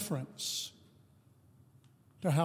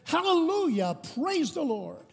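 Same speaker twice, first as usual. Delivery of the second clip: a much higher, ringing cry of praise; Pentecostal outburst; 9 semitones higher than usual. Retold or imitated. Lady interrupts the service a third time, ringing joyful cry. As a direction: throw the praise up high once more. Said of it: "one more time she jumped up and she said".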